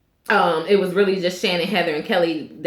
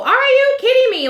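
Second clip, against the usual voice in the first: very high, louder Shannon impression; mocking Shannon's pet phrase, pitched way up and loud; Shannon's catchphrase; 6 dB louder than usual. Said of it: "And then Shannon with the whole".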